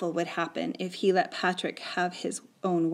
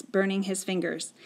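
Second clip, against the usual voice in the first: ordinary delivery, nothing marked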